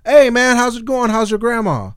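high, loud overfriendly greeting; butter him up cheerfully; high, loud fake-friendly greeting; fake-friendly guy. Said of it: "some guy was like".

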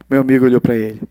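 ordinary talk

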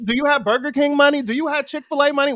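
loud, high scolding question; mock parent challenge. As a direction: grill the kid like a fed-up parent. Acting parents' stock question.

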